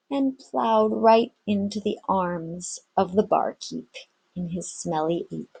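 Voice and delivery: ordinary delivery, nothing marked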